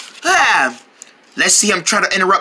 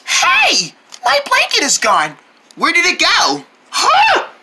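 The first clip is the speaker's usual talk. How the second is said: high, startled cries; squeaky disbelief at the missing blanket; shocked outrage; 8 semitones higher than usual. Acted. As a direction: discover the blanket gone. Finds blanket stolen.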